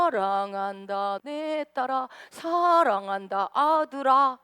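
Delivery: slow, drawn-out tender words; slow loving voice of God; tender God's voice. Pretend. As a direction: stretch each word lovingly. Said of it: "항상 뭐라 그래요?".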